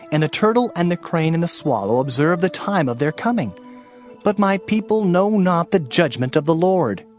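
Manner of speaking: ordinary talk, nothing marked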